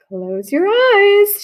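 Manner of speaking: high, slow, soothing therapist voice. Acted. Therapist lulling Camilla, slow and soothing. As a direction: draw the words out calmly.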